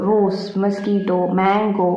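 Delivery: normal talking voice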